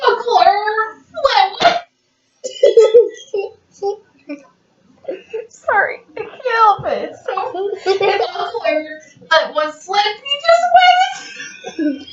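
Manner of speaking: high, helpless teary laughing; crying with laughter. Real, not acted. Parent reading to kids, unable to stop laughing. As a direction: squeak the words out through tears of laughter. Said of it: "I'm laughing so hard".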